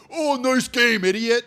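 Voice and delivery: mock a sports hater's taunt; a hint of a taunting rival fan; jeering hater. Mimics jeering Yankee haters.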